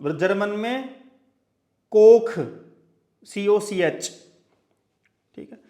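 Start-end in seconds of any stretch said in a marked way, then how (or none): 1.9-2.3 कोख said loudly, ending in a rasping German ch; teacher loudly demonstrating German कोख; German pronunciation. Shown as a demonstration. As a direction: belt out कोख with a guttural ch. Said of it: "कुक को बना दिया German में".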